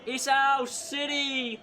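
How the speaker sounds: "our" stretched, high and loud over slow "city"; triumphant shout. Real, not acted. Fan gloating to the rival city after a derby win, triumphant. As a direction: bellow it for the whole stadium.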